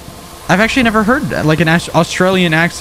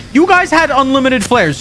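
squeaky mock complaint, pitched way up; taunting high squeal; high mocking whine; 11 semitones higher than usual. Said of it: "Shut your mouth".

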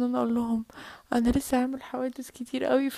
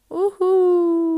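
long high loud cheer; thrilled at movie night; excited whoop; 5 semitones higher, 6 dB louder, much slower than usual. Acted. (صيحة حماس)

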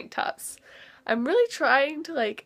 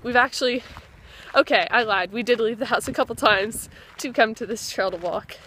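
usual speaking voice

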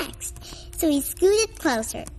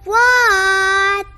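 yelled reply; 5 semitones higher, 6 dB louder than usual. Acted. Froggy answers mother again.

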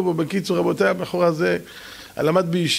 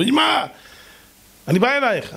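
calling out; 8 semitones higher than usual. Retold or imitated. Son calls his mother on phone.

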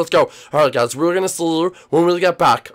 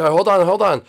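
ordinary talk

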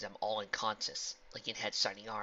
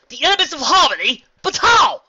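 very high, loud stunned cry; shocked villain; 19 dB louder than usual. Acted. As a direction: recoil in disbelief.

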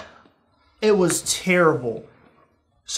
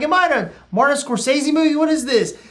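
high, rushed disbelief; mock panic; 7 semitones higher than usual. Customer among suspected Russian mobsters, mock panic. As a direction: blurt it high and fast, alarmed. Acted panic at mob scene.

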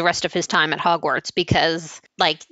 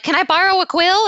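put-on high voice, a bit louder; mock high-pitched request; 11 semitones higher, 4 dB louder than usual. Sleep-deprived student asking to borrow a quill, mock high voice. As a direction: pitch it way up as an acted question.

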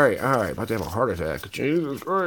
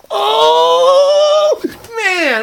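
excited shout; 6 dB louder than usual. Real, not acted. Huge pull, ghost cranked off.

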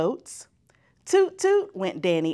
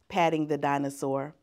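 usual speaking voice

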